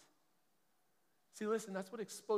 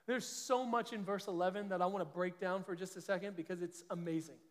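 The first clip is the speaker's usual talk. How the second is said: ordinary talk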